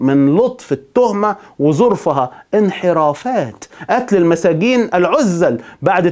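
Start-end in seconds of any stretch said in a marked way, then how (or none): 2.5-3.6 drawn-out, slow mock echo of the charge; mild sarcasm. Real, not acted. Narrator mocking how gently the charge was worded, mildly sarcastic. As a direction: stretch it slowly with light irony.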